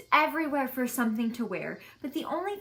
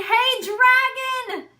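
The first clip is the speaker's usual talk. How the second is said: very loud, much higher, slow drawn-out shout; 12 semitones higher, 10 dB louder, much slower than usual. A narrator's reading. Elizabeth shouting into the dragon's ear, full shout. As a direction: yell it as loud as you can, slowly. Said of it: "She shouted as loud as she could".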